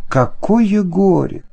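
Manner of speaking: usual speaking voice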